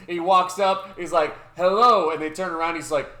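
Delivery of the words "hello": retold loud greeting. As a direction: belt out the greeting as the guy. Retold guy greets girls loudly.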